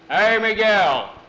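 slow, drawn-out high holler; hailing loudly. Acted. Jose arrives and hails Miguel, calling out loudly. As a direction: holler across the courtyard.

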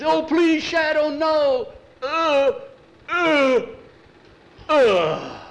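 put-on high, loud begging child; begging the shadow to spare him; terrified pleading.

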